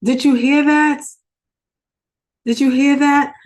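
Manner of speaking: stirred up, pressing the question on and on; emotional excitement. Real, not acted. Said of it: "this gets me emotional".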